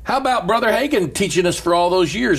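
building sermon cadence; preaching. Acted. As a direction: preach it up with fire.

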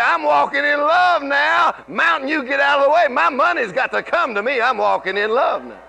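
commanding the mountain to move; bold faith declaration. Shown as a demonstration. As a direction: declare it boldly over your money. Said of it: "You can say".

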